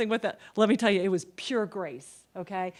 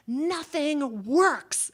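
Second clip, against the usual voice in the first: drawn-out, higher-pitched mock yell; imitated yell; 6 semitones higher, 4 dB louder, much slower than usual. Father's life-lesson to audiences, imitated long yell. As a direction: stretch both words out in a big imitated holler. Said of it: "and he yells it".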